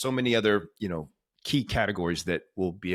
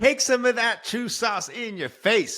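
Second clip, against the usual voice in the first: much higher, louder, slow triumphant cheer; hyped exclamation; 12 semitones higher, 8 dB louder than usual. Real, not acted. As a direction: crow it out like a mic drop. Host hyping guest's bold claim.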